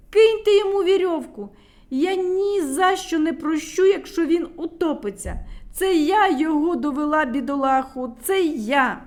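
high, loud, distraught cry; guilty shout. A narrator's reading. Medunytsia shouts in guilt on the bridge. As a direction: blame herself at full voice. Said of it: "кричала медуниця".

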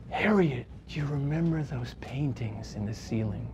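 soft, low, hushed remembering; wistful uncle. Retold or imitated. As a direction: lean in and say it gently, lost in memory. Old uncle remembering, moved.

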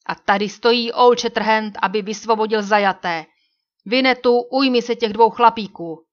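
loud, commanding call. A narrator's reading. Old Shatterhand announcing himself to the guards, loud. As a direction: announce yourself boldly and loudly. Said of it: "rovněž tak hlasitě".

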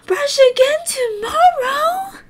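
very high, slow whiny cry; squealing dismay. Real, not acted. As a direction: whine in mock despair. Mock dismay at game.

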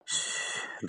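a soft, breathy shh to cut him off; shush. A narrator's reading. Virinchi hushing Bhonsle to stop his story, a soft shh. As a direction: hush him with a whispered shh.

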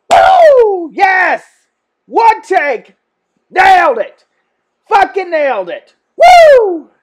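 loud, high whoops; triumphant cheering. Real, not acted. Singer celebrating a one-take jingle, real excited shouting. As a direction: celebrate in short, loud bursts.

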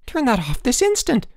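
high-pitched indignant order; urgent demand. A narrator's reading. Roommate demands radio be switched off.

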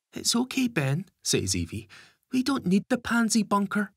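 put-on high, eager little girl; chirpy child reassuring brother. A narrator's reading. Little Evie cheers Ben.

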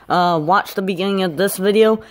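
ordinary talk, nothing marked

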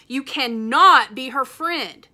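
loud, slow, angry shout at his sister; furious big-brother shout. A narrator's reading. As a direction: yell it at her, dragging the words. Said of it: "shouted Evan".

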